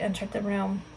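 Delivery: plain reading voice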